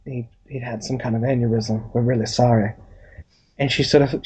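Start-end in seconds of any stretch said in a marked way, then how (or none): 0.1-2.7 low, halting doctor breaking bad news; lower, hesitant apology as the doctor; Doctor Nolan. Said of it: "Doctor Nolan's there, and he's just like".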